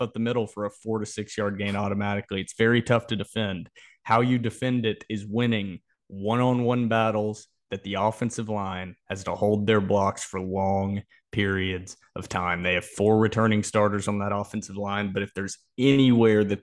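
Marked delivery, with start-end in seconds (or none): none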